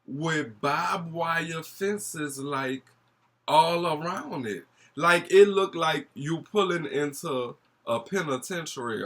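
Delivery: high, slow, shocked storytelling; incredulous. Real, not acted. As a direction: draw out the shock. Dramatic arrival at barbed wire.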